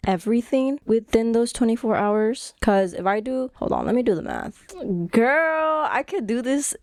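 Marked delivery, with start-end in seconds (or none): 5.1-6.8 a sudden thrilled realisation; excited exclamation. Real, not acted. Realising she can finish everything.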